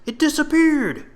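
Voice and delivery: very high, loud, panicked; frightened soldier. Acted. Soldier losing sight of the beast, high panicked cry. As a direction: cry out in alarm.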